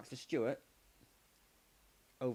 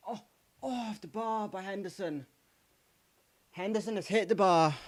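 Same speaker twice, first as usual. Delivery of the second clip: higher, louder excitement; excited cry; 6 semitones higher, 5 dB louder than usual. Real, not acted. Fan reacting to his team hitting the bar, excited cry. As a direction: gasp it out as it hits the bar.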